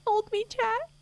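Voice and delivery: high mock-frightened plea through voice mod; scared horror game streamer. Real, not acted. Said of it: "I'm scared".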